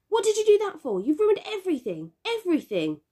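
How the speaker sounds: put-on furious boy yelling at sister; angry yell. A narrator's reading. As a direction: shout it at your little sister. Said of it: "I yelled at her".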